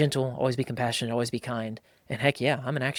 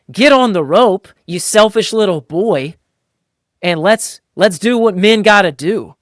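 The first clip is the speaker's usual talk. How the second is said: loud, higher, slowed rant; mock macho outrage; 7 semitones higher, 10 dB louder than usual. A reader fuming at the lazy crewman, hammed-up loud rant. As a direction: bark at the slacker to get on the rope. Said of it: "And I remember just being like".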